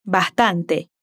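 Bastante with the S breathed out like an h, "bahtante"; aspirated S. Shown as a demonstration. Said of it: "también aspira la S al decir bastante".

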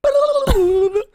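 high squeal of laughter. Real, not acted. Laughing at video.

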